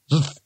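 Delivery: "Zf" as a buzzed z sliding into f; spell pronunciation. A narrator's reading. Rincewind guesses at snow runes, "Zf" as pure consonants. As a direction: sound "Zf" with no vowel at all. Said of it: "it should be pronounced".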